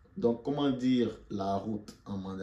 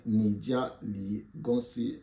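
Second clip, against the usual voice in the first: slow demo; much slower than usual. Shown as a demonstration. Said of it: "teacher demonstrates phrase slowly".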